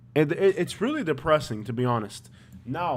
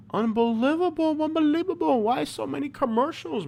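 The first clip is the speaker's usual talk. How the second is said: much higher, whining in disbelief; exasperated mock whine; 12 semitones higher than usual. Real, not acted. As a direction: groan it high like you're fed up with ads. Annoyed at ads on videos.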